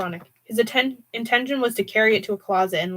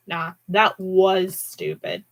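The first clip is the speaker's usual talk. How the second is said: ordinary delivery, nothing marked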